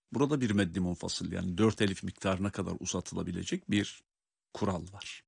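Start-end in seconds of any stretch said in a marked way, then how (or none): none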